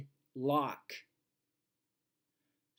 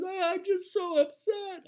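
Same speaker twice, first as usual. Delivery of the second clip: very high, wailing mock sob; hammed-up crying teacher; 6 dB louder than usual. Teacher wails at the inspector, hammed-up sobbing. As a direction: overplay a weepy teacher, very high and loud. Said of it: "And she goes".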